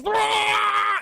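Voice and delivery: high, loud dying roar; villain scream. Retold or imitated. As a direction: roar like a villain being cooked.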